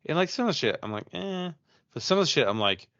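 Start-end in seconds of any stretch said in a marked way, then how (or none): 1.1-1.5 high, doubtful little "eh"; dismissive "eh". Retold or imitated. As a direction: shrug it off with a higher-pitched noise. Unimpressed reaction to doubtful videos.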